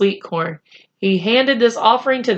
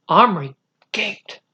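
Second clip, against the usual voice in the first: usual speaking voice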